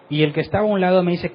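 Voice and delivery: normal talking voice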